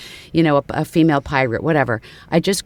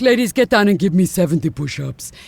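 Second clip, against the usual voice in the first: barking Eastern European drill order; Eastern European gym teacher; 3 semitones higher than usual. Shown as a demonstration. Gym teacher ordering the ladies to do push-ups, in an Eastern European accent. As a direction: shout orders at the class like a foreign gym coach. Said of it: "Eastern European type gym teacher".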